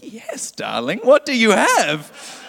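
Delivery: high, slow grand passenger voice; hammed-up posh flyer drawing it out; posh indulgent passenger. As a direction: play the pampered first-class guest. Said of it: "I'm like".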